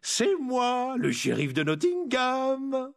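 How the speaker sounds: high, slow singsong announcement; gleeful sing-song call; amused sheriff. Acted. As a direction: draw out your name teasingly, high. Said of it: "dit une voix amusée".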